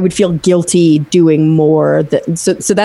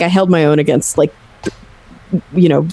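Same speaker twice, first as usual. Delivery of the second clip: usual speaking voice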